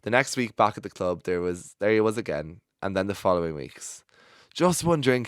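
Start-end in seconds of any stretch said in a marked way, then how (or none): 4.5-5.3 high, coaxing ask. Retold or imitated. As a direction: wheedle for one drink. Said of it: "he said".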